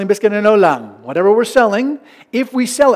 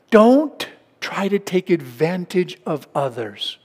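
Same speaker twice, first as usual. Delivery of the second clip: ordinary delivery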